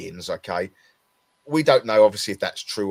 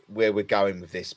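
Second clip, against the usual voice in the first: ordinary talk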